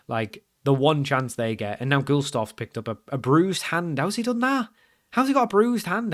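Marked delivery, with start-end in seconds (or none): 4.0-6.1 high-pitched, baffled questioning; incredulous. Real, not acted. Player baffled by his keeper's bruised hand, incredulous.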